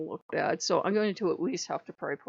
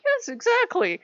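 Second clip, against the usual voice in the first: much higher, louder eager yes; eager agreement; 5 dB louder than usual. Retold or imitated. Agreeing with Asmodeus, who finally understands her, eagerly excited. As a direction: jump at it, delighted he gets it.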